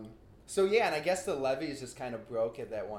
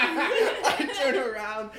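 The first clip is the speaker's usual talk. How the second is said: laughing; 8 semitones higher, 8 dB louder, slower than usual. Real, not acted. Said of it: "I can't stop laughing".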